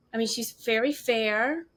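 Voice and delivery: normal talking voice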